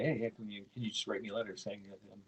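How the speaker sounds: ordinary delivery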